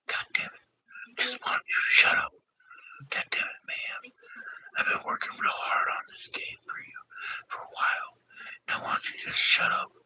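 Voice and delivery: whispered scolding on the side; whispering. Real, not acted. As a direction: hush someone under your breath. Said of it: "I'm whispering to the other dude".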